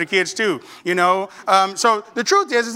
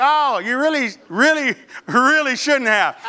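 feigned gratitude; 2 semitones higher than usual. Retold or imitated. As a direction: lean on each really, politely disappointed. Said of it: "You know you got some of them gifts".

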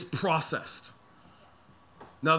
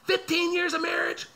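spiraling complaint; 9 semitones higher than usual. Retold or imitated. Racing mind about the wife, building mock outrage. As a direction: pitch climbing higher and higher in mock outrage. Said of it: "my mind can race inside of these things and be like".

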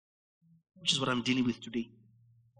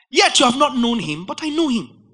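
1 bold retort; 10 semitones higher, 9 dB louder than usual. Acted.

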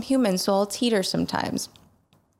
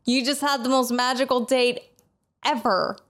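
friend's teasing voice; 6 semitones higher, slower than usual. Retold or imitated. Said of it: "she goes".

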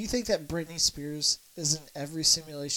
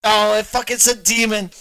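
loud, raised-pitch protest; outraged outcry; 4 semitones higher, 10 dB louder than usual. Real, not acted. Host hears the clip prove him wrong, loud outrage. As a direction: exclaim it in loud disbelief.

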